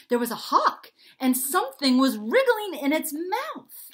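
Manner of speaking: high, loud, excited tale-telling; dramatic storyteller. A narrator's reading. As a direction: build up the suspense loudly. Charlie tells his story dramatically.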